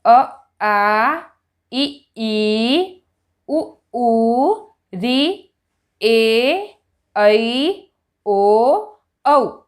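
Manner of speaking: short vowel quick, long vowel held; long vowels held clearly longer; vowels with clear length. Shown as a demonstration. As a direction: teach each pair with the long one doubled. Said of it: "हम बच्चे को स्वर ऐसे सिखाएंगे".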